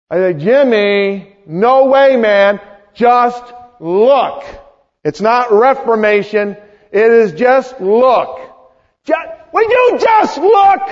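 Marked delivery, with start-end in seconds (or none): 0.3-4.4 higher, louder exasperated pleading; frustrated. Acted. Friend arguing with stubborn Jimmy, frustrated. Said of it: "Wouldn't you get a little frustrated". 5.0-10.8 exasperated, building to a high-pitched yell; frustrated. Acted. Friend begging stubborn Jimmy to just look, frustrated outburst. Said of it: "Wouldn't you get a little frustrated".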